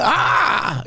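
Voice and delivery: high, playful "ah!"; mock Superman yell. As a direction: cry out like a joke superhero. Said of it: "Exactly how Superman sounds too".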